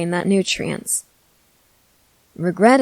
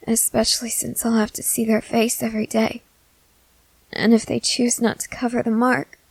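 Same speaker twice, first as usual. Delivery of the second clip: put-on nervous young companion; 4 semitones higher than usual. Companion confessing fear of feeding to the vampire, nervous and higher. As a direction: let the worry sit under every line.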